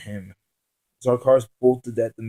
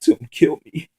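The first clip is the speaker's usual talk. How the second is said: higher, louder tearful begging; weeping plea; 5 semitones higher, 10 dB louder than usual. Acted. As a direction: beg through tears. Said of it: "The man helplessly wept".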